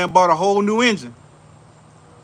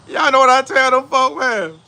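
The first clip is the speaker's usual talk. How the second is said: loud, high laughing question; 6 semitones higher, 5 dB louder than usual. Real, not acted. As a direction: laugh it out to the crowd. Still laughing, louder.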